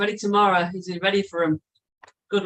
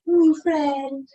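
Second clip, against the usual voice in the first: high sing-song teasing; mocking tease; 7 semitones higher, 4 dB louder than usual. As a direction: tease him about his friend. Teasing a mate's bromance.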